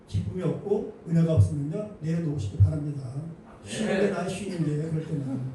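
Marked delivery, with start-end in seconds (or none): none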